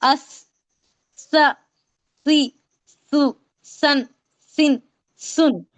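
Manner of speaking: अस, स, सी, सु, सन, सिन, सुन with th as in "think"; tongue tip past the teeth on each of अस, स, सी, सु, सन, सिन, सुन; lisped drill from अस to सुन, each syllable separate. Shown as a demonstration. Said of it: "कैसे पढ़ेंगे आप इसको".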